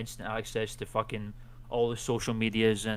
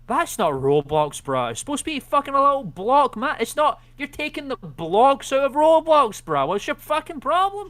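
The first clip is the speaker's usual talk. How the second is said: mock outrage; 13 semitones higher, 6 dB louder than usual. Mock outrage at Roblox limbs.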